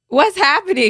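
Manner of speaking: high, loud imitation of a startled partner; retold alarm. Partner reacts to sudden crying.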